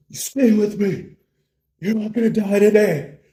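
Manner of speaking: high, loud desperate command; action-hero yelling to rouse him; melodramatic shout. Acted. Bro smacks his fading friend awake, shouting desperately.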